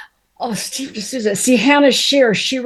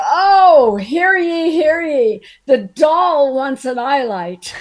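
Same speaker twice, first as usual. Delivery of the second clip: higher, mocking town-crier proclamation, loud opening "Oh"; mock gruff announcement; 5 semitones higher, 4 dB louder, slower than usual. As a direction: imitate a gruff man proclaiming a diva's demand. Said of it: "He was gruff, you know, he was kind of gruff".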